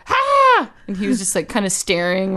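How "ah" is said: high excited yelp. Retold or imitated. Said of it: "And then I was like".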